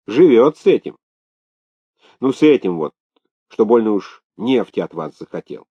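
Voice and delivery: scandalised tattling with punched, swooping pitch; gossipy, indignant maid. Acted. Maid unloading fresh Sakhalin gossip on the governor, indignant. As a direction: dish the dirt indignantly.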